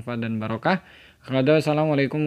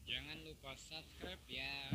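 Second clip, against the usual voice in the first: foreign voice; 2 semitones higher, 23 dB quieter than usual. Shown as a demonstration. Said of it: "Suara orang asing".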